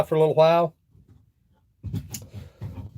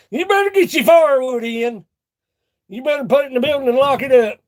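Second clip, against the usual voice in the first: louder, higher mock alarm; loud joking warning; 8 semitones higher, 5 dB louder than usual. Warning viewers about the firewood thief, loud and teasing. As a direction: bellow the warning like a joke.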